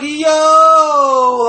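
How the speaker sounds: shouted yo. Retold or imitated. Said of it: "I was like".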